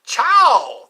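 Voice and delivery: loud, high shouted farewell; exuberant Italian goodbye. Acted. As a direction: call it out big and high. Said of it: "that's how they say it".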